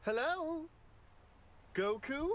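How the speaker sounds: high sing-song call to Goku inside. Acted. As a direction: call up to him like through a wall. Whis calls into Goku again.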